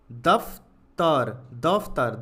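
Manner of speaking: दफ्तर drawn out slowly. Shown as a demonstration. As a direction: stretch दफ्तर out for the class. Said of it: "तो ये क्या बनेगा?". (धीरे खींचकर दफ्तर)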